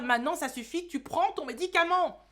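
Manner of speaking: cri exaspéré, très fort et aigu; maman qui crie. Acted. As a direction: hurler comme une mère à bout. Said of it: "Qu'est-ce que c'est bon de crier". (maman à bout crie sur son fils)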